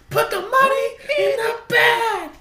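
high shouted robber callback; bank robber shout. Pretend. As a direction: yell it like the robber again.

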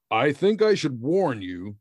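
ordinary talk

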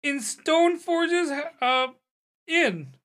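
high, loud delighted exclamation; thrilled squeal. Acted. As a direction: burst out with giddy excitement. Innkeeper thrilled about darts.

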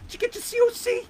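high, needling question; mock suspicious boss. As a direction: squeak the question at him. Baker questioning the narrator.

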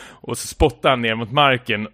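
ordinary talk, nothing marked

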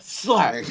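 loud, high yelled slap; mock slap sound. Retelling the slap scene, a loud yelled slap. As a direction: bark out the slap noise.

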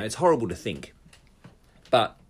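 normal talking voice

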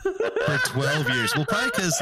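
high-pitched words through laughter; laughing. Real, not acted. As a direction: laugh while getting the words out. Laughing at absurd dispute.

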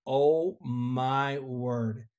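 hushed, drawn-out disbelief, retold; stunned awe. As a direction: draw out each word, dumbstruck. Stunned reaction to sudden silence.

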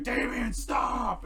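hammed-up stern father yelling; mock shout. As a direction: yell to stop him mid-strike. Bruce shouting at Damian.

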